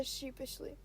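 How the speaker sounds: ordinary delivery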